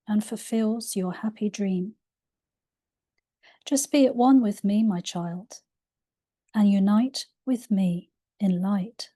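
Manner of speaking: ordinary delivery, nothing marked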